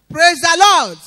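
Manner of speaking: loud call-out to the congregation; praise shout. Real, not acted. Woman testifying calls out praise to the church, loud shout.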